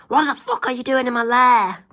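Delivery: a mad villain in a lightly feminine voice; feminine Doofenshmirtz. Acted. Doofenshmirtz finding an intruder in his lair, high and feminine. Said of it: "I said Doctor Doofenshmirtz's voice more like a feminine voice".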